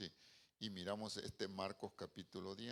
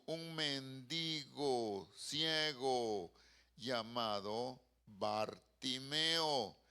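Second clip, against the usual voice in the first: solemn scripture reading; 3 semitones higher, 7 dB louder than usual. Acted. Preacher reading the Bartimeus story to the congregation, declamatory. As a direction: proclaim the verses, stretching phrase endings. Reads the Bible passage aloud.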